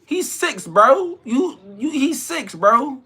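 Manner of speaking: can't believe what you're hearing; high-pitched outraged "he's six, bro"; exasperated disbelief. Real, not acted. Incredulous rant at a bragger.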